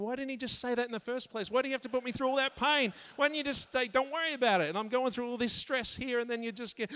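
high, loud mock-outraged rant; exasperated. Venting thoughts after needless stress.

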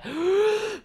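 high, drawn-in gasp; big gasp for air. Pretend. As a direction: gulp air as if surfacing. Coming up for air.

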